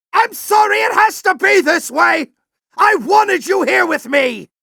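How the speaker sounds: high, pained shouting; anguished shout. Acted. General shouting regret at Ratchet mid-battle.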